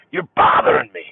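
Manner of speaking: high, breathy irritated snap; annoyed snap. Retold or imitated. A dad reading the newspaper snapping at his kid. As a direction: push the kid away with annoyance. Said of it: "bugs the you-know-what out of me".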